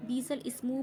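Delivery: "बीसल इस्मु" split before ismu, long bee; common misreading. Shown as a demonstration. Teacher showing a common mistake, "बीसल इस्मु". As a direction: say "बीसल इस्मु" as two spoken words. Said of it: "बहुत लोग पढ़ते हैं".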